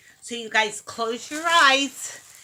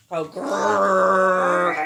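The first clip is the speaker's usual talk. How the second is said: growl; 7 semitones lower, 4 dB louder than usual. Shown as a demonstration. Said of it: "Can you growl?".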